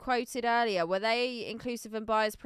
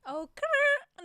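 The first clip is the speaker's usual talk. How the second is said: much higher squeaky attempt that breaks off; a strained high copy of the cry; failed Celebi cry attempt; 5 dB louder than usual. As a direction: reach for the high creature cry and fail. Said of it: "no, I can't do it".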